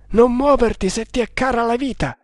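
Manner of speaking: high-pitched urgent order; urgent warning. A narrator's reading. Hunter warning servant to stay still, urgent order. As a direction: warn him high and urgently, short of shouting.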